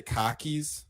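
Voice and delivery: "cockies" with no R, long open ah; Boston pronunciation. Shown as a demonstration. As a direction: say "cockies" with the R dropped. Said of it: "you know how I say cockies?".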